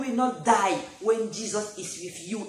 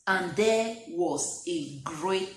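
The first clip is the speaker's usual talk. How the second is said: slow reading; 3 semitones lower, slower than usual. Real, not acted. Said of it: "The Bible said".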